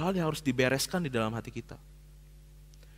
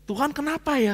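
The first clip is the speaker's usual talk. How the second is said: pleading prayer; 10 semitones higher, 4 dB louder than usual. Retold or imitated. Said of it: "Saya butuh".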